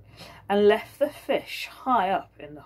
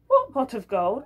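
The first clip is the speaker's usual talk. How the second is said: higher, louder echo with a rising tune; mock echo; 7 semitones higher, 5 dB louder than usual. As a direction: repeat his question back, teasingly. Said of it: "Oh, that pot of gold".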